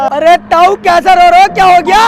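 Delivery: loud, high startled questioning; alarmed concern. Acted. Passer-by to a weeping old man, alarmed. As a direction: call out in alarm to him.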